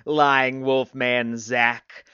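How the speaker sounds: very slow, put-on loud taunt; mocking crack. A narrator's reading. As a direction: stretch every word into a jeer. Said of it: "cracked Anatoly".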